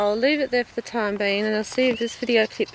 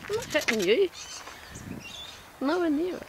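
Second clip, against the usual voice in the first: higher, sing-song coaxing to a bird; 7 semitones higher, 3 dB quieter than usual. Real, not acted. Talking to a bird that keeps swooping, high coaxing voice. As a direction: soothe a cranky bird, pitched up.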